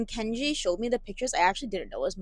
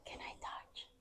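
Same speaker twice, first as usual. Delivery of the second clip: whispering; 8 semitones higher, 6 dB quieter than usual. Real, not acted. Whispering in a cave.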